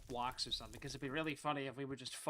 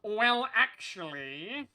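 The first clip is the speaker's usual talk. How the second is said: very slow smug drawl; smug know-it-all; 6 semitones higher, 10 dB louder, much slower than usual. Retold or imitated. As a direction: draw it out like a pedant. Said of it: "To be like".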